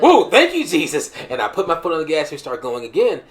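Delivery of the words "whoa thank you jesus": high, loud burst of relief; relieved thanks. Retold or imitated. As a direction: gasp out thanks after the near crash. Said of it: "I was like".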